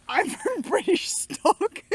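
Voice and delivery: slow, high, hammed-up British voice; mock posh Brit. Wife mocking husband's British line, slow put-on voice. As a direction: drag it out like a stuffy Brit. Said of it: "You're the, like".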